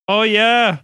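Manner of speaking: put-on high, hammy Indian accent; bad Indian accent. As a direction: lay on a cheesy Indian accent. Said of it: "I gotta work on my Indian accent".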